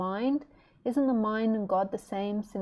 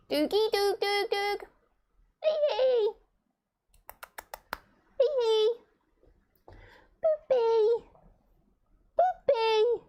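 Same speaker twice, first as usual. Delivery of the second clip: very high cooing nonsense; baby talk to pet; 13 semitones higher, slower than usual. Acted. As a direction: coo sweet nonsense at the bird.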